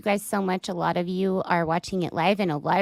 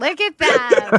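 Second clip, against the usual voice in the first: gushing; 5 semitones higher, 8 dB louder, slower than usual. Real, not acted. Fawning over his eyes.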